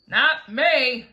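loud, cheerful boast; happily. Acted. Centipede brags to the bugs, loud and happy. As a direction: proudly shout it out. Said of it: "said the centipede happily".

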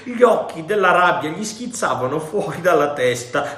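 usual speaking voice

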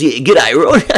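bright Kiwi g'day; cheery greeting. Shown as a demonstration. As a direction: greet the neighbour happily. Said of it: "Nobody comes up to a fence and goes".

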